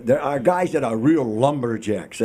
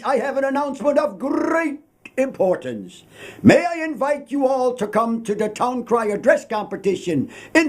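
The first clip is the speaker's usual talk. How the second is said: high, ringing proclamation cry; town crier; 11 semitones higher than usual. Shown as a demonstration. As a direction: call out to the whole town square. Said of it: "This is what a town crier would normally do".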